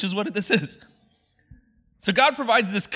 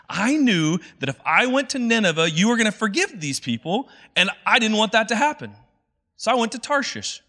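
higher, whining complaint to God; whiny complaining Jonah; 7 semitones higher than usual. Retold or imitated. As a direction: whine it out like a sulking prophet. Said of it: "complaining to God".

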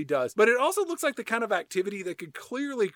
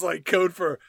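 normal talking voice

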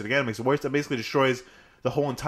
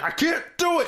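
frustrated shout. Retold or imitated. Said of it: "And he's like".

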